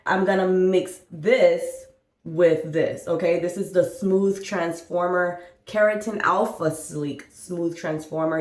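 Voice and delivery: normal talking voice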